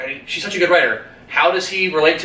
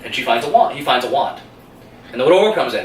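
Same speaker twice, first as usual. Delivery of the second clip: usual speaking voice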